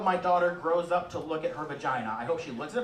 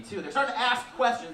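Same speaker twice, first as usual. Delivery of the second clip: normal talking voice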